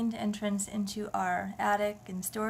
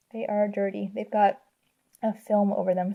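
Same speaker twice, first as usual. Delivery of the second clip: mouth full; 6 dB louder than usual. Real, not acted. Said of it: "I've got a Tootsie Roll in my mouth".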